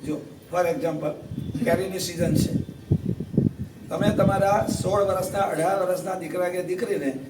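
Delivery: quiet heartfelt sermon passage; sincere soft appeal. Real, not acted. Soft earnest preaching.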